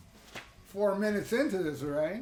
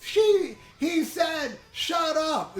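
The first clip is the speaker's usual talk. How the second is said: high-pitched mock shouting from memory; mock scream; 9 semitones higher, 4 dB louder, much slower than usual. Host recalling a woman in a video, mock scream. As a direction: imitate her scream, pitched well up. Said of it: "she's screaming at the end".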